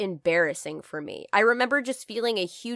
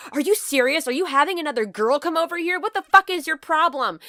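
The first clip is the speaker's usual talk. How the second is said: higher, louder accusing outburst; jealous girlfriend yelling; 7 semitones higher, 4 dB louder than usual. Retold or imitated. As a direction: imitate her furious jealous accusation. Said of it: "she was like".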